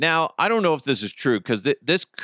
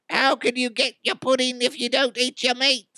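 quoting [name]; 10 semitones higher than usual. Retold or imitated. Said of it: "starts quoting [name]".

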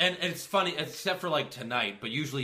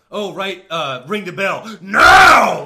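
a hammed-up yell, louder and slower at the end; mock yell; 6 semitones higher, 17 dB louder, slower than usual. Kane yelling for the bell in a hammed-up yell. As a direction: bellow the last words like a bad actor. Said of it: "worst acting when he yelled".

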